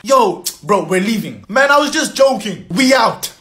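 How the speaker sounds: ordinary delivery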